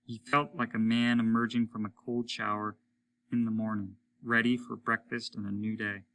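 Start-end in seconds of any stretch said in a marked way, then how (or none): none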